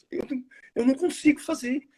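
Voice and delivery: high, panicky plea; panicked. Acted. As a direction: beg off in a panic. Panicked actor refusing to go on.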